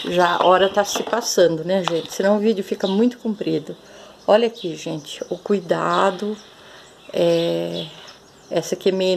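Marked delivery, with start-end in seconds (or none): 2.1-3.7 fast, hurried delivery to save time; talking fast. Real, not acted. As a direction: rush through it so the video stays short. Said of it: "Vou falar meio rápido". (falando rápido, com pressa)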